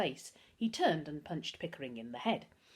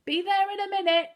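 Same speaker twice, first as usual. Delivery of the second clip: much higher, loud call down the stairs; bellowed; 14 semitones higher, 8 dB louder than usual. A narrator's reading. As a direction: bellow it down to the front door. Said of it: "he bellowed".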